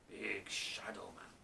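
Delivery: hushed, slow whisper. Shown as a demonstration. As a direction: breathe it like a secret menace.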